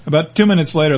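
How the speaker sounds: ordinary delivery, nothing marked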